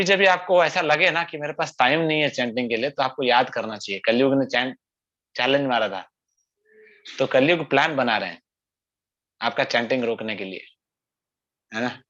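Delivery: usual speaking voice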